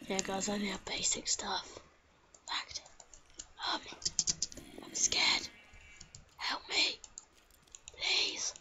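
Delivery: scared breathy whisper pleading for help. Pretend. Gamer hiding in a dark cave, scared whisper. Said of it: "Why the hell am I whispering?".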